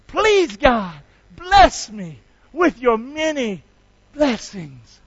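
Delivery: loud, slow prayer line to repeat; leading a prayer. Shown as a demonstration. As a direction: draw each word out for the congregation. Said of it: "do that one more time".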